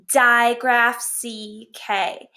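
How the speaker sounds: very slow, spaced-out recital; slow model phrase. Shown as a demonstration. Teacher showing how we would say it, slow. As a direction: give each part its own slow beat. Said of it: "we would go".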